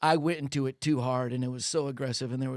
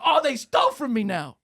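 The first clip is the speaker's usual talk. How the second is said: higher, louder incredulous cry; mock disbelief; 10 semitones higher, 6 dB louder than usual. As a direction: act shocked they took it back. Waiter reacts to money taken.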